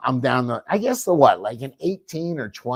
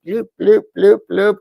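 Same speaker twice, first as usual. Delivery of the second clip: imitated fob beeps; 10 semitones higher, 11 dB louder than usual. Imitates car fob beeping.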